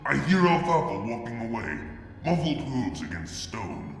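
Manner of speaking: plain reading voice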